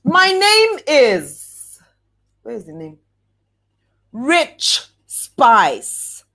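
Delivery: slow grand intro. Shown as a demonstration. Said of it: "mock producer intro".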